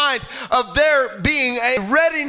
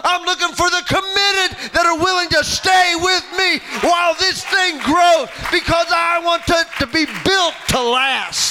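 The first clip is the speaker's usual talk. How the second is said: higher, driving shouted preacher cadence; rally the congregation to commitment; preaching at full force; 4 semitones higher than usual. Acted.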